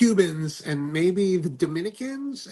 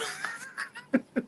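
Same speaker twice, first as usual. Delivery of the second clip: high laugh; 8 dB quieter than usual. Real, not acted. Joke about hotel.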